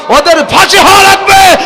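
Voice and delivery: preacher's shouted demand; rousing shout. Real, not acted. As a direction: whip the crowd up, call for a reply. Preacher rousing crowd for response.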